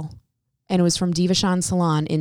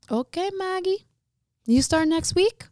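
playful high take on the boss hiring her; imitating the boss, high; 11 semitones higher than usual. Boss telling her she starts next week, high breezy voice. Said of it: "he was like".

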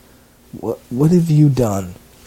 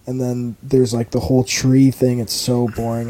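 normal talking voice

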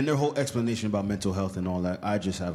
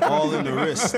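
laughing; 12 semitones higher, 5 dB louder, slower than usual. Real, not acted. Laughing bragging repeat.

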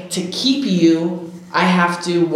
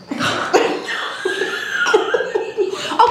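embarrassed laugh; 14 semitones higher than usual. Real, not acted. Laughs after admitting ghosting.

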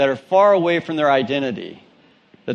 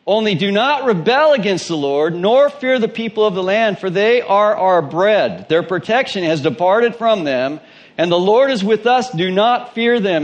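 raised, fervent Bible reading voice; scripture reading; 6 semitones higher, 3 dB louder than usual. Acted. Preacher reading Numbers aloud to the congregation, fervent. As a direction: proclaim the passage with rising fervour.

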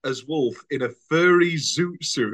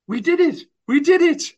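excited cheer; 12 semitones higher, 5 dB louder than usual. Acted. Acting the baker's cheer.

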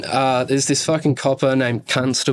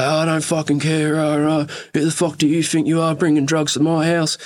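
mildly heated, slightly higher retold rant; angry retort; 4 semitones higher than usual. Stepdad berating his guest over drugs, mildly angry. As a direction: play a riled-up stepdad having a go.

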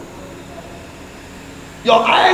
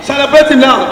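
usual speaking voice

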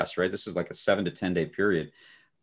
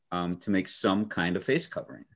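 ordinary talk, nothing marked

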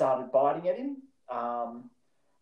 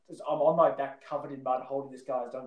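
normal talking voice

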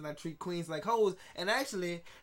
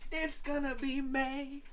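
"It's gonna be May" stretched out slowly and pitched higher, a mock boy-band croon; [name] impression; 9 semitones higher, slower than usual. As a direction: drawl "gonna be May" high and slow like the meme. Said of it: "[name]".

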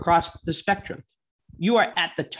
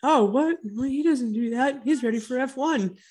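mock defence, higher; sarcastic fanboy voice; 7 semitones higher than usual. Sarcasm, then says he loves Herta.

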